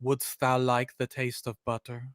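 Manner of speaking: low, soft, eerie movie line; softly sinister goat voice; devil's whisper-like hint. Retold or imitated. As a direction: lure them in low and quiet. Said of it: "my hint would have been".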